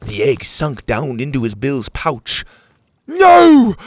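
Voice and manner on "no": very high, very loud cry; anguished shout. Acted. Petey watching the egg get swallowed, high loud shout. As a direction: scream in protest. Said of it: "Petey shouted".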